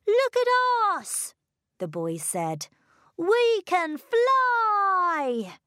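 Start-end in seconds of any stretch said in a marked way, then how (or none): 0.1-1.3 high, delighted call; excited boys. A narrator's reading. 3.2-5.6 high, drawn-out joyful cry; excited boys. A narrator's reading. Boys delight in flying.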